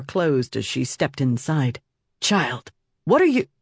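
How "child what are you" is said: startled rising cry; shocked, voice leaping high; alarmed. Acted. Startled at armed child.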